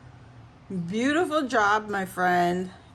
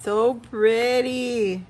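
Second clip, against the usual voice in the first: drawn-out gush; 4 semitones higher, 7 dB louder, much slower than usual. Real, not acted.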